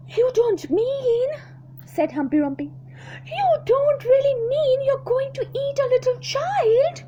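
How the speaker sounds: can't believe what she hears; horrified hippo. Acted. Hippo aghast at the crocodile, high shocked.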